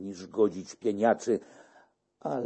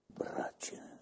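whisper; 12 dB quieter than usual. A narrator's reading. Monk confides a terrible memory.

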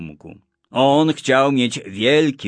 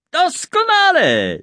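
high, drawn-out delighted cry; excited exclamation; 4 dB louder, slower than usual. A narrator's reading. Boy finding the perfect explanation, exclaiming high with delight. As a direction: call it out thrilled, slowly. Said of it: "zawołał Kuba".

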